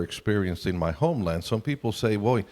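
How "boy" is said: ordinary talk, nothing marked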